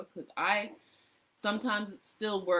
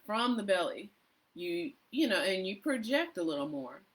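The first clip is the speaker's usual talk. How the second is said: ordinary delivery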